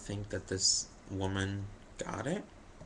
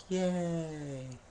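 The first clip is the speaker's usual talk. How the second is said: higher, mock-cheery yay; sarcastic yay; 8 semitones higher than usual. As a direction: cheer with no real joy. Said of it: "so I'm just like".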